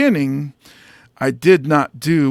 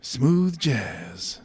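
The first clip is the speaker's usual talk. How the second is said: low, soft jazz DJ voice; smooth radio DJ; 4 semitones lower, 5 dB quieter than usual. Acted. Comic wishing he sounded like that, smooth DJ. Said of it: "nice and they sound smooth".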